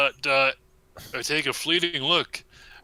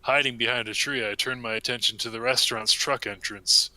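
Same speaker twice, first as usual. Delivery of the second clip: ordinary talk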